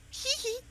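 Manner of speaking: squeaky little villain giggle "hee hee"; high giggle. Retold or imitated. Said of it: "he was more like".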